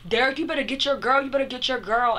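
high, quick imitation of a complaint; retold urgent warning. Man telling Derek to get his girl, mock urgent. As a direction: rattle it off high, as if calling someone in.

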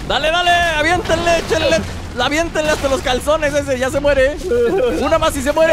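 high-pitched, urgent rallying cries; excited urging. Real, not acted. Player rallying teammates to finish off a dinosaur, excited urging. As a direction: egg the team on, voice pitched right up.

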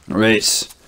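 ordinary delivery

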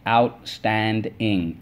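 "Outstanding" stretched slowly as out-stand-ing; syllable by syllable. Shown as a demonstration. Teacher leading the class, slowly syllable by syllable. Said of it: "let's go".

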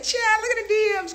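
loud, very high gushing; retold excited exclamation. Reacting to the shh emojis, loud high squeal. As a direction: squeal it like juicy gossip. Retelling reading DMs at nail shop.

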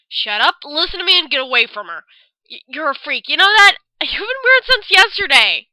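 angry argument. Acted. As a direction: tell her off in rising anger. Ren argues with Yuta.

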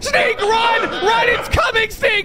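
high, loud frantic warning; panicked shouting. Pretend. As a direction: scream at them to run.

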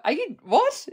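high, puzzled double take at mum's words; baffled surprise. Retold or imitated. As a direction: pitch it up in confused disbelief.

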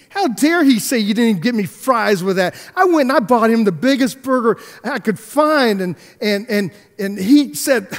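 high, loud, incredulous ranting; mock fury at a thankless stranger; retold outrage. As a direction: replay the insult in disbelief. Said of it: "sitting there seething".

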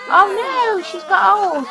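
high, loud mock dismay. Real, not acted. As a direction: gasp that she's aged. Sim ages up at party.

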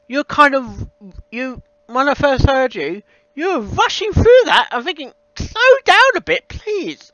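ordinary talk, nothing marked

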